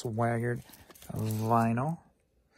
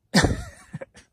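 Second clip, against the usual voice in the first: high, loud tired giggle burst; giddy squeal laugh; 8 dB louder than usual. Real, not acted. Randomly laughing while tired, loud squealing laugh.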